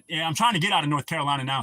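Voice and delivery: usual speaking voice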